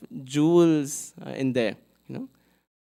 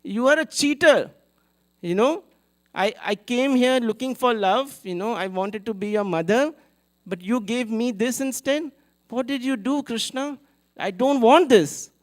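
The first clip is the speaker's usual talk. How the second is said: heartbroken reproach; 8 semitones higher, 5 dB louder than usual. Retold or imitated. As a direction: cry out to Krishna as the one who cheated her. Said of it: "He said".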